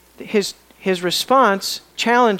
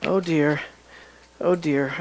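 rueful; 6 semitones lower, 6 dB quieter than usual. Real, not acted. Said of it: "lament over Gideon".

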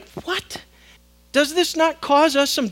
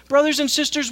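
ordinary talk

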